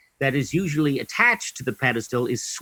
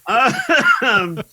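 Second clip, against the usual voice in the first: laughing; 13 semitones higher, 7 dB louder than usual. Real, not acted. Bursts out laughing after joke.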